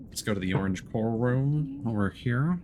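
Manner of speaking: ordinary talk, nothing marked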